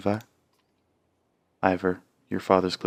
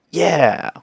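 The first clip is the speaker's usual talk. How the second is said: loud triumphant yell; excited shout; 9 semitones higher, 9 dB louder than usual. Real, not acted. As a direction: cheer it out loud. Streamer cheers at game menu.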